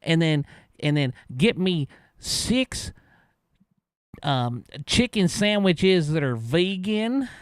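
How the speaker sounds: slow, drawn-out ordering voice; imitating the customer. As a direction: drag out a long ridiculous order. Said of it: "let me say it like he did".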